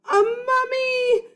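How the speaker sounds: high, loud, slow shriek; terrified shout. Acted. Annie sees the mummy, terrified high shout. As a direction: scream it as the mummy swoops in. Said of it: "Annie shouted".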